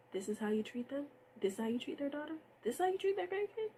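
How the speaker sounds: rising disbelief, question after question; incredulous outrage building. Real, not acted. Outraged repeated rhetorical questions.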